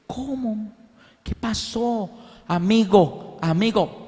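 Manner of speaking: high, muffled imitation of a deaf boy trying to speak; deaf child's speech. Retelling how the deaf brother asked for help. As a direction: mimic a deaf child struggling to form words.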